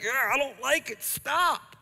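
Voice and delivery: high-pitched, whiny mock protest; mock unnerved reaction. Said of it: "it's been unnerving. It's been like".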